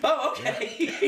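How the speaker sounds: slow, high, laughing mock acceptance; mock meek reply. Son mimicking his own reply to dad, slow laughing mock acceptance. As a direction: drag it out, amused and deflated. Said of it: "I'm like".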